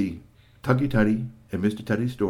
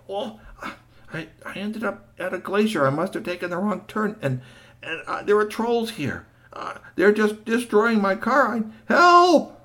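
much higher, pleading, ends in a yell; frantic plea; 11 semitones higher, 4 dB louder than usual. Acted. GP phoning Marvel from the tree, frantic and high. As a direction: beg for rescue, rising to a cry.

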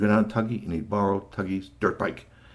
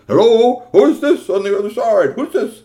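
much higher, loud answering voice; Marvel's voice; 12 semitones higher, 12 dB louder than usual. Acted. Marvel answering an unknown caller, loud and high. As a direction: bellow down the phone.

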